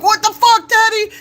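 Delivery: very high, loud shocked outburst; mock pained shriek at the pet; startled yelp at the dog. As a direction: yelp like the dog just bit your foot. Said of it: "He's like".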